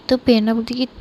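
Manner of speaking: plain reading voice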